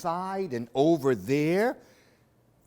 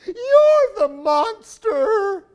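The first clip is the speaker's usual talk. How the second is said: loud, much higher, slow shout; frightened yell; 12 dB louder, slower than usual. Acted. The owl yells at the bear in fright, loud and high.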